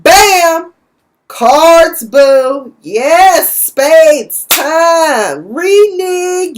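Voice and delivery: high, loud cheering call; excited shout. Real, not acted. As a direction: whoop it out like game night is here. Excited about card game.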